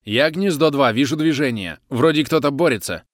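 sniper report. Acted. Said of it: "прошипела докладом снайпера".

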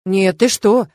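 exasperated, effusive reassurance; sighing protest. A narrator's reading. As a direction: protest with exaggerated gratitude. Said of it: "вздохнул папа".

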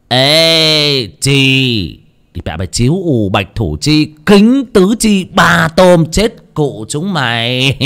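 high, drawn-out triumphant card-winner's cry, ending in laughter; loud gleeful crowing. A narrator's reading. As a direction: crow over a winning hand, then cackle. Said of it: "ông sướng rõ to".

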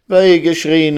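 high, loud cry of woe; unhappy outcry. Acted. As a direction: cry out in dismay. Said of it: "rief die unglückliche Resel". (unglücklicher Aufschrei)

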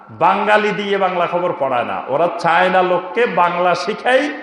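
ordinary delivery

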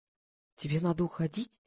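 softly alarmed question; hushed, mildly frightened. A narrator's reading. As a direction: ask softly, with a touch of fright. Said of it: "испугалась Глаша".

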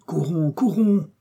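high, drawn-out cry to run; high-pitched rallying call; urgent call. A narrator's reading. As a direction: urge a companion on, stretching the words. Urging companion to run.

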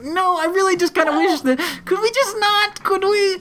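very high, squirming mock plea; imitated panicky pleading; sweating bullets. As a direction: beg nervously in a high, strained voice. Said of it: "he's, like, sweating bullets".

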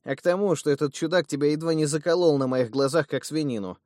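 high, loud, worked-up scolding; heated retort. Acted. Brother scolds the ungrateful thug in a loud, high voice. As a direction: throw it back at him, loud and high.

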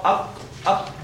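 throaty qaf demo. Shown as a demonstration. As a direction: clip أك back in the throat. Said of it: "أصبحت قاف".

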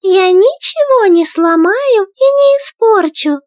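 high tiny princess voice, pleading; child begging. A narrator's reading. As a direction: beg sweetly like a little girl. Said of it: "упрашивала царевна Горошинка".

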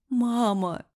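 high, despairing cry; wailed, desperate call; hopeless moan. A narrator's reading. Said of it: "Совсем от безысходности простонала, сдаваясь".

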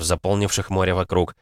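ordinary delivery

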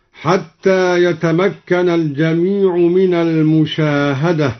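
normal talking voice

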